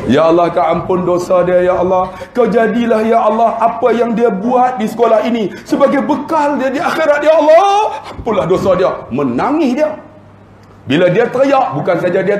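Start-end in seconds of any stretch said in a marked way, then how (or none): 0.1-9.0 high, building supplication; impassioned mock prayer. Praying for the principal's forgiveness, rising impassioned doa voice. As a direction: plead upward to a high, wailing peak. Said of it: "Tu dalam doa hentam lagi tu".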